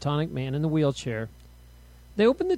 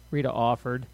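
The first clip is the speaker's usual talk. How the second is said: ordinary delivery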